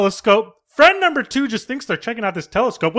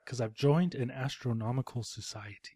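much lower and much softer, a different voice; put-on low voice; 8 semitones lower, 15 dB quieter than usual. Friend three explains the society, low and hushed. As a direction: mumble it low and secretive. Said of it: "and he's like".

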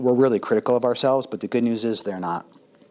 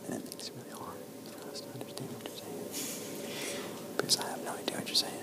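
barely audible whisper; speaking too softly; 17 dB quieter than usual. Shown as a demonstration. Lecturer showing students who speak too softly, barely audible whisper. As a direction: whisper it, far too soft to be understood. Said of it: "you speak too softly".

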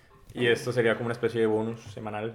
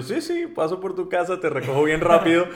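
eager reply; 7 semitones higher, 9 dB louder than usual. Retold or imitated. Retells own innocent reply.

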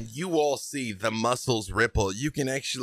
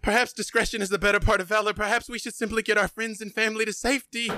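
much higher, fast, urgent plea; pleading brother Armand; 11 semitones higher, faster than usual. Acted. Armand begs his brother to flee, high and fast. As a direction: rush the words out, begging him to back down. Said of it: "he puts a hand on your arm and he says".

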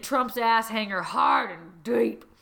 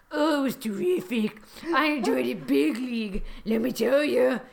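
Trump impression; 2 semitones higher than usual. Reader voices Trump answering Putin after sex, Trump impression. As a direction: play a self-satisfied Trump caricature.

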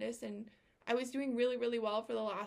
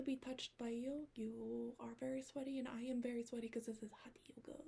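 whisper; 8 dB quieter than usual. Retold or imitated. Continued whispered quote.

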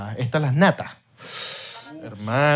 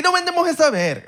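much higher, laughing clerk voice; mock shop clerk, pitched up with a laugh; 13 semitones higher than usual. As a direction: play a fed-up clerk, high and joking. Repeats clerk line.

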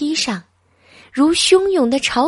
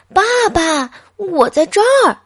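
put-on child's voice, higher and louder; loud call; 10 semitones higher, 6 dB louder than usual. A narrator's reading. Boy calling to his father, loud. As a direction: make dad hear you. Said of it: "就大声喊道".

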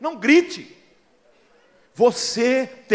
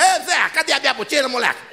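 pai gritando; 7 semitones higher, 5 dB louder than usual. Retold or imitated. Pai gritando com os filhos.

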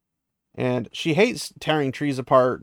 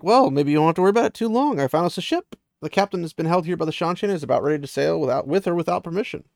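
higher, quicker voice for a hopeful friend; lightly acted excitement, pitched up; retold eager news; 5 semitones higher, 4 dB louder, faster than usual. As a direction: relay good news in an eager, raised voice. Acts out Min announcing an escape ship.

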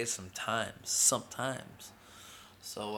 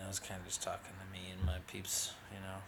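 whispered aside; 4 semitones lower, 8 dB quieter, faster than usual. Real, not acted. Aside to his peeps.

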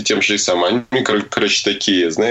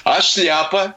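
clueless auntie; 9 semitones higher, slower than usual. Retold or imitated. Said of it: "эта тётушка такая".

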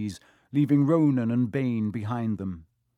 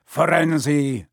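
louder, thundering centaur rebuke; 5 dB louder than usual. A narrator's reading. Outraged centaur confronts his fellow, thundering. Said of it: "Bane thundered".

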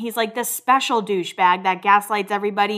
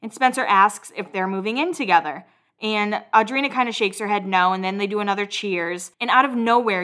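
ordinary delivery

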